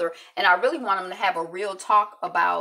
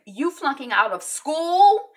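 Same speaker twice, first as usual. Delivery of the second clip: slow, higher and louder, spelling out the bad news; scolding; 8 semitones higher, 7 dB louder, slower than usual. Journalist scolds the sleepy student, slow and loud. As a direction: drag it out like a scolding big sister.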